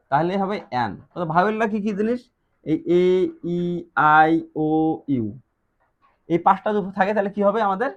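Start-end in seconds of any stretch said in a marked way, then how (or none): none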